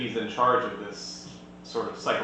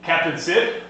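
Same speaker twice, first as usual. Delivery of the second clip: loud, slow, high godlike proclamation; booming divine voice; 5 semitones higher, 8 dB louder, slower than usual. Acted. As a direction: boom it down from the heavens like a deity. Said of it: "a booming divine voice".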